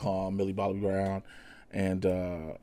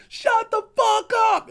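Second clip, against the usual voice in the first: high, loud, slow shriek; hammed-up high-pitched roar of anger; Kong screaming back; 7 dB louder than usual. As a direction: scream it back in a squeaky monster voice. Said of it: "Kong is like".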